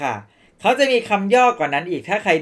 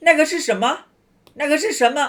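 那个是什么 run together quickly and pitched higher; fast native speed; 11 semitones higher, 5 dB louder than usual. Shown as a demonstration. Teacher showing students how Chinese people really say it, fast. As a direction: blur it into one quick phrase. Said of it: "ไม่ เขาก็จะพูดว่า".

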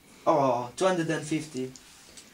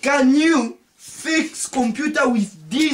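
incredulous demand; 6 semitones higher, 5 dB louder, much slower than usual. Acted. Repeats, slower.

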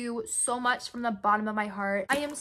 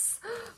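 breathy high gasp, barely voiced; soft gasp; 10 semitones higher, 12 dB quieter than usual. Real, not acted. Vlogger finding little notes, a soft gasp.

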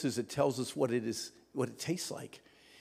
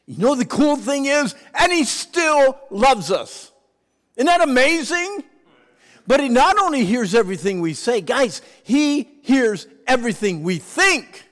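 loud, high fervent preaching; 10 semitones higher, 13 dB louder than usual. Acted. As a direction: shout it to the congregation. Preaching at full force.

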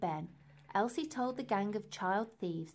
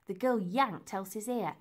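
ordinary delivery

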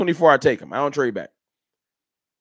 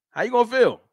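taunting how you gonna feel, higher; teasing challenge; 6 semitones higher, faster than usual. Shown as a demonstration. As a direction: needle the chat with it. Asking chat twice.

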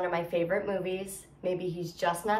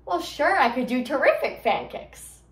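higher, louder, over-confident reply; eager audition answer; 4 semitones higher, 5 dB louder than usual. Acted. Dancer answering the man at the music hall audition, eager. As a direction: jump in to sell yourself.

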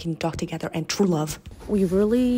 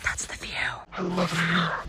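whisper; slower than usual. Real, not acted. Whispering to the scenic view. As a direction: breathe the words with no voice.

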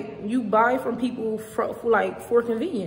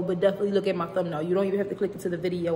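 rushed, rapid delivery; fast talking; 3 semitones lower, much faster than usual. Real, not acted. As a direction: hurry through it. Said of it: "I'm talking fast because".

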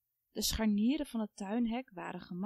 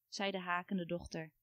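usual speaking voice

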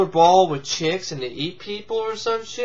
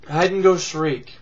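ordinary delivery

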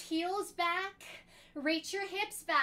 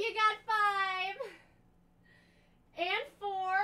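effortful, slightly louder counting; straining; 4 semitones higher, 6 dB louder than usual. Real, not acted. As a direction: push the words out through burning legs. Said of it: "my legs are burning".

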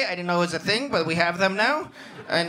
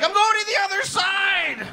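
mock yell; 13 semitones higher than usual. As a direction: shout the switch of sides. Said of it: "He's like".

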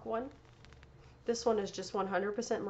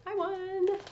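excited; 10 semitones higher, 7 dB louder than usual. Real, not acted. Announcing she won the prize.

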